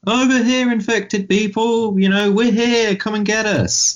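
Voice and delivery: high sing-out call to attract distant attackers. Acted. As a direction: holler it in a mock high-pitched voice. Acting out a signal to the infected.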